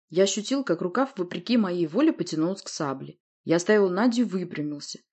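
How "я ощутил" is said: ordinary delivery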